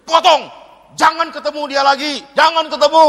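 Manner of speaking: high, loud, urgent prohibition; shouted command. Real, not acted. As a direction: order them sharply to stop. Forbids meeting the lover. (larangan keras setengah berteriak)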